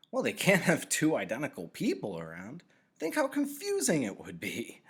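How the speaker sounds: put-on a young boy's eager reply; Jonas voice. A narrator's reading.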